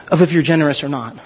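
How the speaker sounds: ordinary talk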